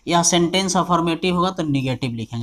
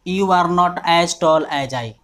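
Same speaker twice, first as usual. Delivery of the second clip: slow dictation; much slower than usual. Shown as a demonstration. Teacher dictates example.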